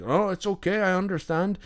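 high, lilting Irish reassurance; thick Irish accent. Retold or imitated. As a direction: imitate an Irish attendant letting it go, pitched well up. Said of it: "this thick Irish accent".

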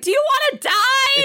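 very high, hammed-up squeal of an old man's threat; mock yell. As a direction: screech the threat, half-joking. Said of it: "he's yelling at him".

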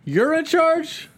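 high, slow disbelieving question; incredulous. Pretend. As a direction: stretch out the disbelief. Mocking the character's claim.

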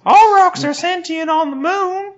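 very high, put-on cartoon voice; high squeaky fabulous rock voice; talking moon rock. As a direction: play a vain rock showing itself off. Still voicing the moon rock.

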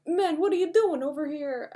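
put-on high, loose stab at Stallone's accent; rough Stallone accent, not quite landing; attempted Stallone accent.

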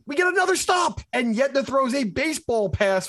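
it's the third stop and they keep blowing it; exasperated. Real, not acted. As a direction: pitch jumps up in disbelief. Exasperated recap of blown chances.

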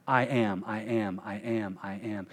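"I am" four times, sinking to near silence; fading repetition. Acted. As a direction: let the repeats die away to a hush. Repeating, fading out.

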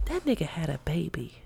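hushed gossip whisper, no voice at all. Real, not acted. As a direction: lean in and breathe it like a secret. Gossiping shocked aside.

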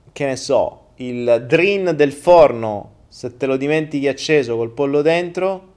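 ordinary talk, nothing marked

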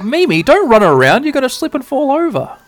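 high, loud, bossy warning; scolding girl voice. Acted. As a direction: fuss like a big sister. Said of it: "And Sora's like".

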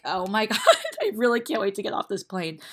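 laughing in disbelief. Real, not acted. Weary passenger on landing, laughing as she talks. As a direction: laugh through the fed-up line.

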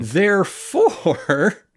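very slow, high THEREFORE, rising at the end; hammed-up, rising "therefore"; grand mock deduction. Same word repeated more grandly.

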